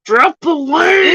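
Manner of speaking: high, stretched-out wail over the clip; drawn-out heckling. Acted. Heckler cutting in on a played clip, high drawn-out cry. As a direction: draw it out long and high, crying out.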